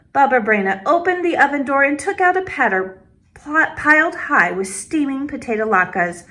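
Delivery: usual speaking voice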